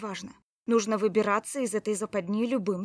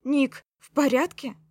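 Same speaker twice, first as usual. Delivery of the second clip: «Ник, в порядке?» called loud and anxious; worried loud call; 4 semitones higher, 9 dB louder than usual. Acted. (тревожный окрик «Ник, в порядке?»)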